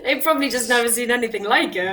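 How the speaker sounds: laughing it out loud. Real, not acted. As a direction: say it through laughter. Laughing reply.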